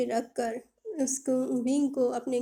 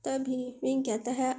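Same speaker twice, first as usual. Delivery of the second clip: ordinary delivery, nothing marked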